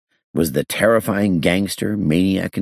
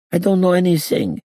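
put-on very high accented protest; agitated denial. A narrator's reading. Gangster denying it again to the inspector, high agitated voice. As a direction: deny it hotly, pitch shooting up. Hincus protests more heatedly.

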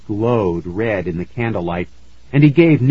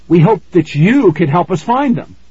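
high, loud, desperate plea; frightened pleading; 8 semitones higher, 6 dB louder than usual. Acted.

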